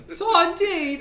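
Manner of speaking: a laughing, hammed-up cry of sardines; laughing mock yell. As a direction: shout it high through laughter, Cockney-ish. Mimicking Carol Burnett, laughing.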